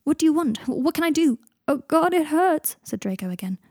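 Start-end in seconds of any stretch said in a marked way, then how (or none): 0.0-1.4 high, urgent questions; desperate to help, voice pitched up; Harry frantic beside Draco in pain; panicked. Acted. 1.7-2.8 high, slow pained moan; in agony. Acted. Draco in agony.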